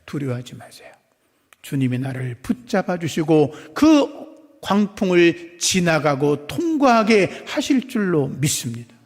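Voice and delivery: ordinary talk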